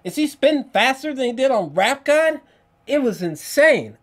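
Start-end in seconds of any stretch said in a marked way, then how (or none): 0.0-2.4 high, loud amazed outburst; much higher and louder in astonishment; amazed exclaim. Retold or imitated. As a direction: gasp out the disbelief. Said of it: "I was like".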